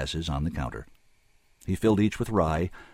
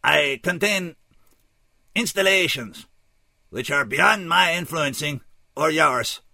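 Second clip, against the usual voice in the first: put-on high, loud, oddly accented alien; measured, detached alien, pitched well up; dispassionate alien emissary; 10 semitones higher, 5 dB louder than usual. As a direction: report it formally in a stilted alien voice. Voices the alien stranger.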